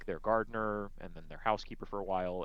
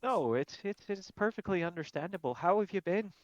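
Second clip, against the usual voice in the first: higher put-on voice, kind; kindly halfling voice; 12 semitones higher, 4 dB louder than usual. Glamdry kindly telling River it's understandable. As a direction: reassure her warmly. Glamdry speaks as his character.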